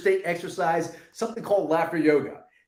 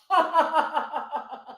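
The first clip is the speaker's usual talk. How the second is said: light laugh going down; chuckling laugh; 8 semitones higher than usual. Shown as a demonstration. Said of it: "chuckle it down with a laugh".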